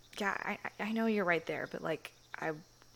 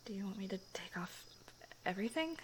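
soft hushed question; timid whisper; shy whisper; 8 dB quieter, faster than usual. Acted. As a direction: ask it almost under your breath.

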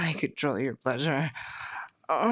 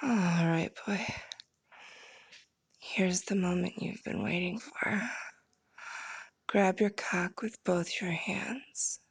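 intimate whisper. Acted. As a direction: whisper it close into the ear. Whispered instructions resume.

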